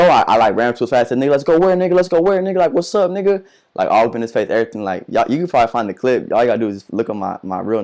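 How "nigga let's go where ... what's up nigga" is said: much higher, louder challenge; retold angry challenge. Retelling squaring up to a teacher, shouted challenge. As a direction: get in his face, shouting, retold.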